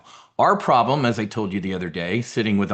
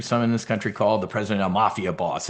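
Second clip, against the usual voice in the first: ordinary talk, nothing marked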